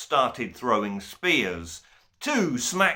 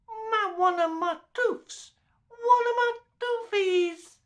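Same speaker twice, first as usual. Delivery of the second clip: put-on baby babble. A narrator's reading. Baby giggling and gurgling.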